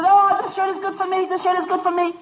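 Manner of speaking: high, lightly voiced little boy insisting; a light sing-song child clinging to his shirt; child pleading.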